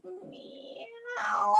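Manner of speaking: very high, soft meow; a dainty kitten meow. Pretend. Teacher leading cat stretches, very high soft meow. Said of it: "pretend to be a cat".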